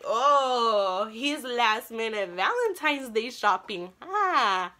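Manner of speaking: loud, drawn-out retold exasperation; loud theatrical complaint; mock outburst. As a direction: burst out with a hammed-up groan, stretching it. Mock outburst while he shops.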